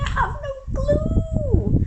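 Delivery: a comic despairing cry; mock wail. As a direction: wail it like you're about to burst into tears. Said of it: "I'll be like".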